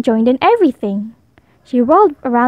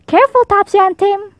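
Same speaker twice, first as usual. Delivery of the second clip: raised, higher warning call; 8 semitones higher than usual. A narrator's reading. Mother calling to her twins from the bench. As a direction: call across the park to the kids. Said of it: "called Mommy".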